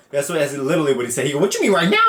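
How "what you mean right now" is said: baffled retort. Retold or imitated. Player questioning the coach's sudden order, mock incredulous. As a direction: blurt it back, incredulous.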